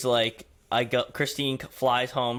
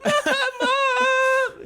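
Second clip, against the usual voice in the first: much higher, louder laughing yell; mock scream crying; 8 dB louder than usual. Brother imitating his sister seeing a bug, laughing mock yell. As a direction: wail it through laughter. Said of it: "Scream crying".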